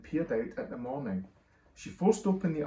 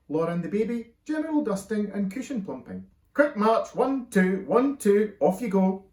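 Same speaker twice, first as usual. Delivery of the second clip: loud, higher parade-ground orders; army sergeant dad; 7 semitones higher, 7 dB louder than usual. A narrator's reading. Father marching children off to chores, commanding. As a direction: drill them like a sergeant major. Dad drills kids like troops.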